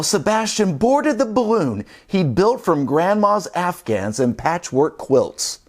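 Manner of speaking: usual speaking voice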